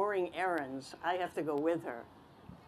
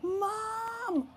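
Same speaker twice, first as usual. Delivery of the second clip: very high, drawn-out call; excited yell. Acted. Boy at the front desk yelling for Mom, excited. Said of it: "I yelled".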